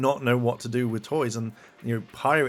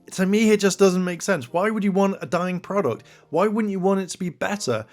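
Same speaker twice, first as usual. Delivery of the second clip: raised, louder voice of disbelief; exasperated; 7 semitones higher, 4 dB louder than usual. Real, not acted. YouTuber questioning fans who want a dying product, exasperated. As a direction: argue it in higher, insistent exasperation.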